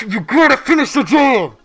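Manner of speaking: high loud mocking whine. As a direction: whine it back at them. Mocking repeat, louder higher.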